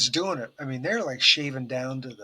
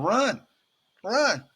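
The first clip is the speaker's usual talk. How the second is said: high, drawn-out mock order to run; urging call; 8 semitones higher, 3 dB louder than usual. Stress-test staff urging a patient on the incline, mock urging call.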